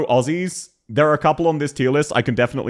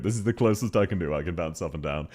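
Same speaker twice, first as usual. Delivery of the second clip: ordinary talk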